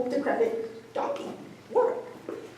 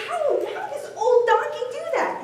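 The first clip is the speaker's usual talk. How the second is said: much higher, astonished question; amazed; 13 semitones higher than usual. Acted. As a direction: ask it in disbelief. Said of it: "the gentleman is amazed".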